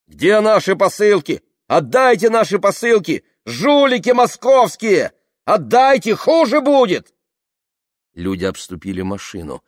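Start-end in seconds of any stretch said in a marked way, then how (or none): 0.1-7.1 high, loud, threatening yells; angry crowd shouts. A narrator's reading. Crowd threatening the Moscow volunteers, angry shouting. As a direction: shout demands like an angry mob.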